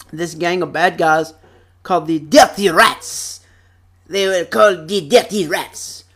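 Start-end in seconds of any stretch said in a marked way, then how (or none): 2.2-3.4 lightly voiced cartoon gangster; imitated gangster rats. Vlogger names the cartoon gang, imitating their voice. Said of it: "that's literally what they sounded like". 4.1-6.0 lightly put-on cartoon bad-guy voice; imitated gangster rats. Vlogger shows how the gang talked, imitating them. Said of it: "they would literally talk like this".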